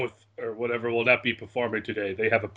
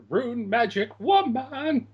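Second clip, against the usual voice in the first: playful cry; 11 semitones higher, slower than usual. Pretend. Playful chant after wrong answer.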